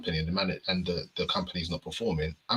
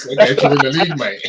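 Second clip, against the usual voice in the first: louder, higher boast; louder, higher hammed-up boast; mock confident manager; 5 semitones higher, 12 dB louder than usual. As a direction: brag it grandly. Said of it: "When he's".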